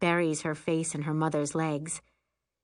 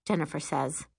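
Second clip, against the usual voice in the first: usual speaking voice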